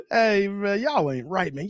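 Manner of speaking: ordinary talk, nothing marked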